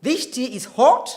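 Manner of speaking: British RP demo with rounded "hot"; British accent. Shown as a demonstration. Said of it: "is typical British accent".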